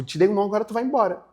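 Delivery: high, loud, quick brush-off; dismissive refusal. Retold or imitated. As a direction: send them away with a final no. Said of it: "É aquele não, tipo".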